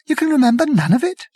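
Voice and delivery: high, fast disbelief; can't believe it; incredulous. Acted. Arthur incredulous.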